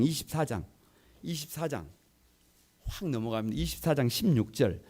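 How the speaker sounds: ordinary talk, nothing marked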